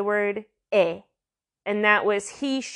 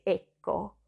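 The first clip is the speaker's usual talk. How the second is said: Italian accent; 6 dB quieter than usual. Shown as a demonstration. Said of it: "if you wanna really Italian it up".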